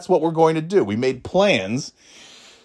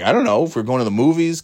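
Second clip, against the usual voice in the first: ordinary talk, nothing marked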